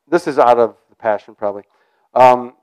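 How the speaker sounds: ordinary talk, nothing marked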